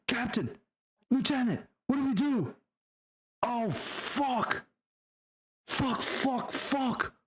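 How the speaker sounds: high-pitched, hammed-up panic; freaked-out soldier voice; panicked sergeant. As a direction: plead for orders with your voice way up high. Acts sergeant panicking at captain's death.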